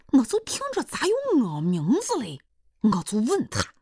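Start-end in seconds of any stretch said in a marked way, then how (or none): none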